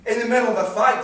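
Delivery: loud, rising protest; loud, affronted question; incredulous. Acted. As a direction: throw it back in disbelief. Husband outraged at interruption.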